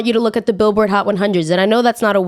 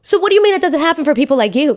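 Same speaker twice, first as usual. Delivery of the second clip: indignant outrage; 8 semitones higher, faster than usual. Real, not acted. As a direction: go off at Harry, really fired up. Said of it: "I'm really angry right now".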